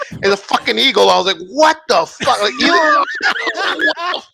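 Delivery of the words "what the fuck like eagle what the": high-pitched hammed-up disbelief, breaking into laughter; astonished yelling. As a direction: shriek it like you cannot believe your eyes. Retelling shock at seeing an eagle.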